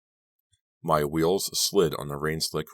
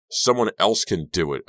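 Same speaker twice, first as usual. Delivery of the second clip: mildly raised, higher yell; yelling back; 4 semitones higher, 5 dB louder than usual. A narrator's reading. Narrator yelling back over her shoulder at Robbie, mild yell. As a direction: toss back an understated yell. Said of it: "I yelled back over my shoulder".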